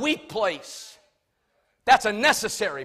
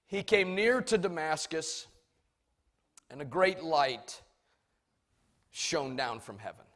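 ordinary delivery

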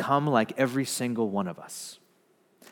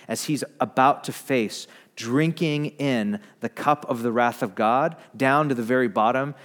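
ordinary talk, nothing marked

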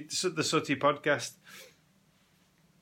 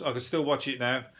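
ordinary talk, nothing marked

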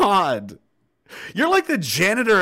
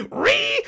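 very high-pitched squeal of mock outrage; mock outraged screech. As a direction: screech like someone absurdly offended. Mimics the outrage of people there.

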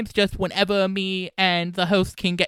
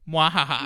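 low mock evil cackle, hammed up; sneering panto villain; 5 semitones lower than usual. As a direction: gloat like a pantomime baddie. Spelt villain laugh after boast.

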